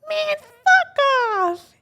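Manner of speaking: high, slow, loud mocking girly voice. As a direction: drag it out high and loud like a brat. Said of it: "she's like".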